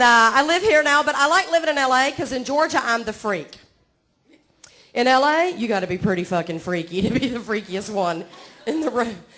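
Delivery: ordinary talk, nothing marked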